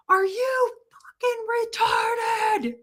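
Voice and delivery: high, strained mock scream with held-back volume; imitated meltdown scream. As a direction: mimic a man screaming in a meltdown. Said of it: "This dude's having a meltdown".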